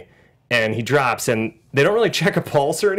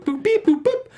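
beeping noises. Retold or imitated. Mimicking guard's button beeps.

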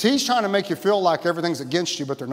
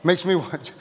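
ordinary talk, nothing marked

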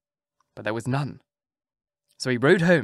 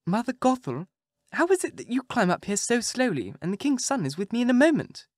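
higher, lightly voiced young girl; innocent maiden voice; 9 semitones higher than usual. A narrator's reading. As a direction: ask it naively like a girl. Rapunzel speaking to the witch.

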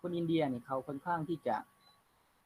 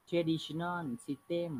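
slow dictation reading; 3 semitones higher, much slower than usual. Shown as a demonstration. Teacher reads text aloud.

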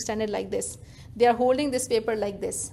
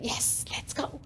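eager whispered yes, much softer; soft whispered let's go; excited whisper; 2 semitones higher, 12 dB quieter, slower than usual. Retold or imitated. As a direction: breathe it like a happy secret. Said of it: "I'll be so happy".

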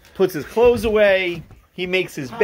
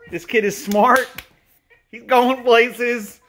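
proud praise; 8 semitones higher, 4 dB louder, slower than usual. Real, not acted. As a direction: brag playfully about a bright son.

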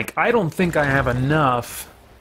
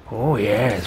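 low gloating voice; 4 semitones lower than usual. Pretend. Gloating over loot.

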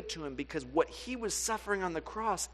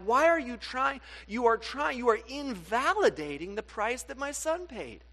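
loud, higher, incredulous rebuke; exasperated God; 8 semitones higher, 5 dB louder than usual. Retold or imitated. Preacher voicing God rebuking people who pay twice, loud and exasperated. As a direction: scold them in disbelief as God. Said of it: "He's like".

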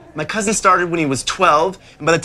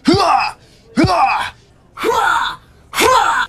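chest-pounding grunt; 12 semitones higher, much slower than usual. Acted. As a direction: bellow it while pounding your chest. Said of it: "pound your chest and go".

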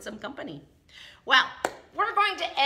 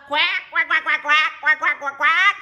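loud, higher rapid quacks; duck quacking; 6 semitones higher, 13 dB louder than usual. Acted. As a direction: quack loudly and insistently. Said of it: "And then the duck".

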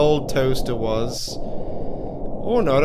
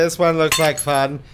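ordinary delivery

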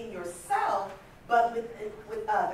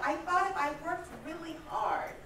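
ordinary talk, nothing marked

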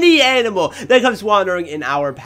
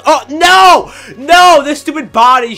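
high, loud yell of dismay; real exasperated gamer outburst; frustrated cry; 9 semitones higher, 8 dB louder, slower than usual. Real, not acted. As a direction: wail loudly at a blocked shot. Kill blocked in game.

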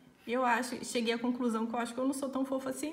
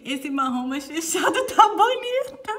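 laughing; 7 semitones higher, 9 dB louder, slower than usual. Retold or imitated. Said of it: "Laura falou assim".

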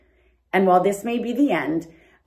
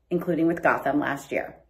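ordinary talk, nothing marked

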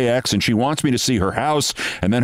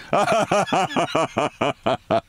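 staccato ha-ha-ha laugh; a long, evenly chopped laugh at his own naivety; drawn-out laugh in separate bursts; mocking laugh; 4 semitones higher than usual. Laughs at his own team-player line.

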